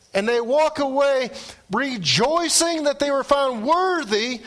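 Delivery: lively pulpit storytelling; preaching. Acted. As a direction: tell the Acts story with fire.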